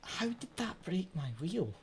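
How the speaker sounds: high-pitched, soft whine; whiny complaint. Real, not acted. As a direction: plead with the game in disbelief. Still sulking over broken wheel.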